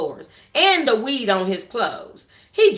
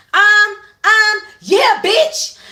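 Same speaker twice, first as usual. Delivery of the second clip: high, loud scorned taunt; scorned woman jeering at Charles; angry taunt; 11 semitones higher, 9 dB louder, much slower than usual. Acted. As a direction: yell it as she spots him.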